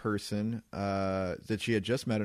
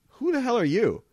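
high, louder mock outrage; indignant retort; 12 semitones higher, 5 dB louder than usual. [name] turning on the rude bar lawyer, indignant. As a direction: snap it back, pitched up.

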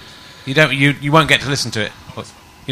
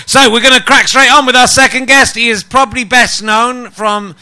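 big, higher showman's introduction, drawn out at the end; host announcing a guest; 7 semitones higher, 6 dB louder than usual. Acted. Host bigging up his guest to the live crowd, announcer voice. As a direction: build it up for the audience like a compere.

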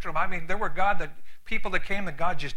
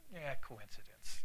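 soft, slow doubtful mumble; dismissive; 7 semitones lower, 14 dB quieter, slower than usual. Retold or imitated. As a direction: shrug it off, quietly. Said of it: "you could see it kinda go".

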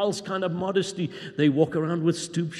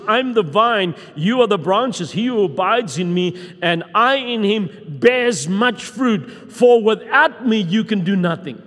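preaching; 3 semitones higher, 5 dB louder than usual. Acted. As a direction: proclaim the scripture like a herald. Quotes Jesus with preaching force.

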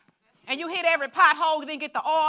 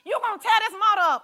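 loud scolding about the oil; mock angry dad, higher and louder; mad father; 6 semitones higher, 4 dB louder than usual. Father scolding his kid over the car, loud and mad. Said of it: "he would literally come out to the car mad".

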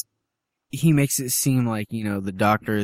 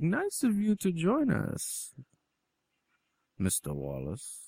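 imitated captor; 5 semitones higher, 9 dB quieter, slower than usual. Captor greeting Wallace at the dinner table, mock courtesy. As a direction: draw it out with mock politeness.

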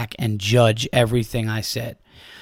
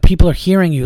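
higher and louder, heated; raised voice; 5 semitones higher, 6 dB louder, faster than usual. Retold or imitated. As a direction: push back hard on him. Arguing with heckler.

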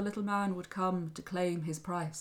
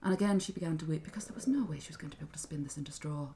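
ordinary delivery

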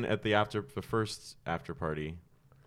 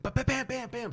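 heartbeat noise. Retold or imitated. Imitating his nervous heartbeat.

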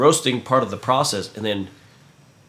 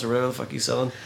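ordinary talk, nothing marked

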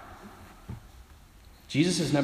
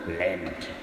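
put-on low, ominous Irish accent; mock-dreadful Irish pronouncement; Irish doom; 8 semitones lower, 5 dB quieter than usual. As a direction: make it sound terrible in an Irish brogue. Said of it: "they always make it sound so terrible".

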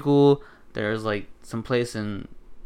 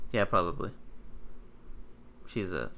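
ordinary talk

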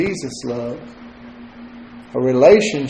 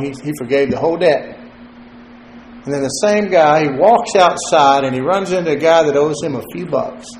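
ordinary talk, nothing marked

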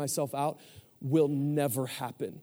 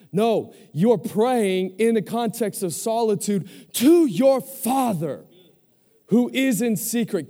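raised preacher's voice swelling to a slow, loud climax; forceful preaching; 5 semitones higher, 5 dB louder than usual. Acted. Preacher telling the congregation who hears their prayers, forceful. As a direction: preach it hard, landing on drawn-out key words.

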